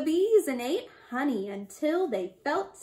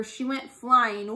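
plain reading voice

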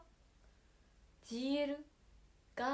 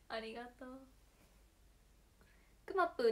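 ordinary talk, nothing marked

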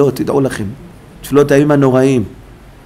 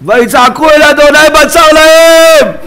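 high, slow, far-carrying shout; crying out loudly; 12 semitones higher, 5 dB louder, slower than usual. Shown as a demonstration. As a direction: roar the verse out as a desperate plea. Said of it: "צועק בקול גדול". (זעקה בקול גדול)

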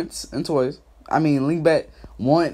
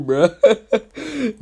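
ordinary talk

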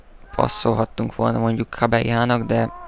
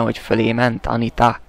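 normal talking voice

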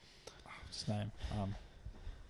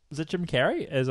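usual speaking voice

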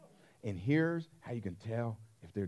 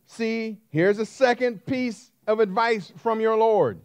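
higher and louder, pounding each phrase; preaching at full force; 8 semitones higher, 8 dB louder than usual. Acted. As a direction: drive the sermon home to the crowd.

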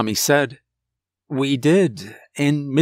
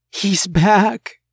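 put-on high, alarmed child's voice; frightened little boy, pitched up; trembling fear; 7 semitones higher, 4 dB louder than usual. A narrator's reading. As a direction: blurt it as a terrified kid.

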